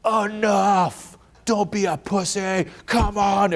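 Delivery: hyped motivator voice. Retold or imitated. Self-help influencer pumping up followers, hammed-up hype. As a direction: ham up a social media hype guru. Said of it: "messages like".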